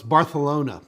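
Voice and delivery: "Barcelona" with a th for the c; playful Castilian lisp. Pretend. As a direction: say "Barcelona" as Bar-the-lona, in mock correction. Continues the joking Castilian lisp.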